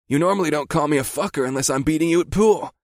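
lightly voiced buddy; friend voice.

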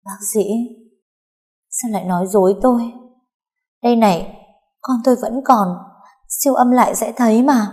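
cling to denial, voice climbing; dazed denial. A narrator's reading. Diệp refusing the doctor's news, rising in dazed disbelief. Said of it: "ngơ ngác cười".

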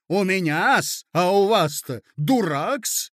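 indignant lady. Acted. Lady confronting her hostess over the sugar story, indignant. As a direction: take offence and stretch the key words.

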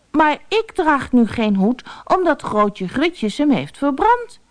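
put-on high boy's voice, earnest; little boy. Boy answers Pieter. (hoge kinderstem, ernstig)

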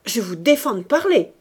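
loud, forbidding command; violent shout. A narrator's reading. Priest refuses to hear her confession, shouting violently. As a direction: forbid it forcefully. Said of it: "cria-t-il plus violemment".

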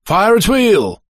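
high shouted order in English; officer's shout. A narrator's reading. British officer to his men, shouting. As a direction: shout the command across the field. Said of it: "закричал офицер".

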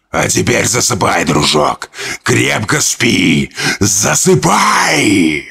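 sinister lullaby. Acted. Creature lulls boy to sleep.

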